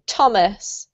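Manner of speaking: Thomas begun with a plain T; T sound, not TH. Shown as a demonstration. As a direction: model Thomas slowly as TOM-us. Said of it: "actually a T sound".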